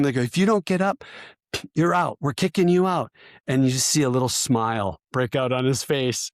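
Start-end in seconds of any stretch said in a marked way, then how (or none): 0.2-3.1 raised, high-pitched threat, retold; imitated yelling. Cadre threatening the soldier in the bag, imitated yelling. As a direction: act out an instructor's yelled ultimatum. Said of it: "they're yelling at him".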